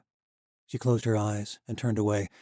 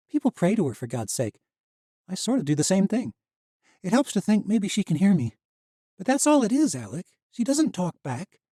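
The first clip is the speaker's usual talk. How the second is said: put-on young woman voice; 10 semitones higher, 7 dB louder than usual. A narrator's reading. Misha's voice.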